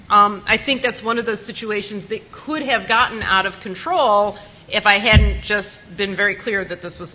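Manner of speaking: normal talking voice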